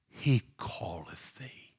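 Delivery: slow, soft whisper; low hushed whisper of comfort; hushed message. Acted. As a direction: breathe it gently like good news. Voiced message to Bartimaeus.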